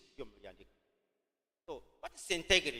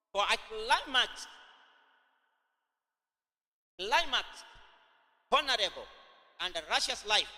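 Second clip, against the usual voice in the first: emphatic repeat; 4 semitones higher than usual. Shown as a demonstration. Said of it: "I repeat again".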